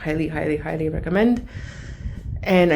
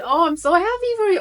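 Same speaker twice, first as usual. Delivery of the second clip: much higher and louder, bursting with joy; excited gush; 11 semitones higher, 7 dB louder than usual. Real, not acted. Congratulating the YouTuber on her book, bursting with excitement. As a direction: let the happiness spill out. Gushing over friend's book.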